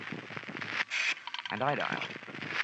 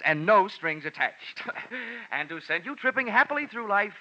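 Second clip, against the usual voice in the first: fake radio DJ; 4 semitones higher than usual. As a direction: sell it like a pushy radio host on the phone. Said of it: "Happy Jack the Money Man".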